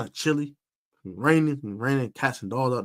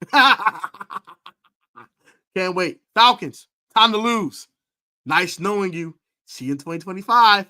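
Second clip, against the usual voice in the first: gleeful taunt; 4 semitones higher, 7 dB louder than usual. Real, not acted. Taunting rival team, laughing.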